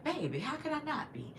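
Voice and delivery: high, lightly voiced Southern US accent; Southern grandmother. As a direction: imitate a Southern grandmother answering tenderly. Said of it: "she had a Southern accent".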